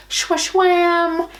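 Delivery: high, loud sing-song reveal; reveal fanfare. Real, not acted. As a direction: present it like a magic trick. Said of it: "Are you ready?".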